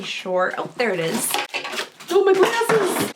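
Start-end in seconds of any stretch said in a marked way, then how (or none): 2.1-3.1 high, slow dismayed cry; real alarm at dropped glasses; startled cry. Real, not acted.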